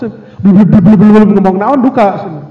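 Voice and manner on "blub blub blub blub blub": loud mock drowning burble; rapid loud "blub blub" babble; bubbling blub noises. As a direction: imitate garbled underwater talk. Imitates garbled sound of someone underwater.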